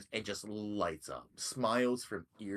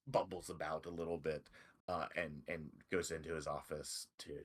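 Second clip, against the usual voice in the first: usual speaking voice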